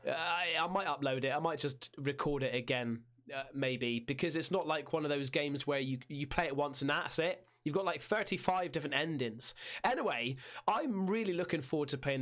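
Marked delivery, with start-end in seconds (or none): none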